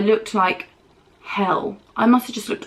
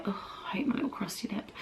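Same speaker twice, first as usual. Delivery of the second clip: whispered grumble; 14 dB quieter, faster than usual. Real, not acted. Muttered aside while doing makeup.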